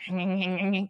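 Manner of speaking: quick little nasal munching imitation of a rat; rat nibbling noise. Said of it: "you know when they're like".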